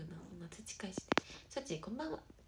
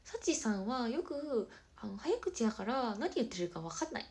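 lightly mimicked complaint, drawn out and sing-song; mock nagging, stretched then louder; うーたん; 3 semitones higher, 7 dB louder, slower than usual. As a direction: quote a friend's complaint, louder with swinging pitch. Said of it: "うーたんによく言われる".

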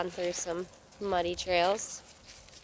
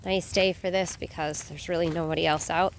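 ordinary talk

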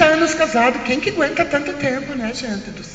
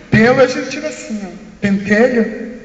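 usual speaking voice

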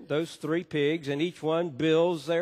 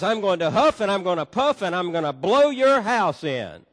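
louder mock wolf threat, higher pitched; 5 semitones higher, 7 dB louder than usual. Pastor voicing big bad wolf threatening straw house, hammed-up loud. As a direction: ham up the wolf's huff and puff threat.

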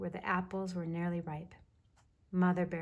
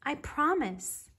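high, slow called-out assurance; mild shout back; 8 semitones higher, 4 dB louder, slower than usual. A narrator's reading. Mother Bear shouts back to her cub, mild slow call. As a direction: call it slowly, pitch lifted. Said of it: "Mother Bear shouted back".

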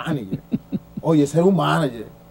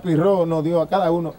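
usual speaking voice

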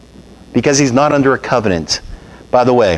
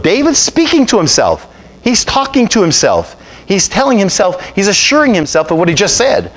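higher, ringing sermon voice; impassioned preaching; 5 semitones higher than usual. Acted. Preacher explaining David's psalm to church, fervent. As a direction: carry the room with fervour.

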